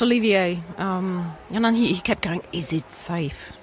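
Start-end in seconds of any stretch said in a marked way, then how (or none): 2.5-3.5 put-on slow, hushed German-accented question; a sinister German accent, low and slow. Retelling the film villain in a light German accent. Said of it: "did a sort of a German accent".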